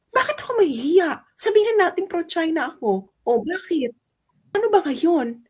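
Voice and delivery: high-pitched, incredulous questioning; defiant challenge. Acted. Defiant retort to her critics. (mapanghamon, mataas ang boses)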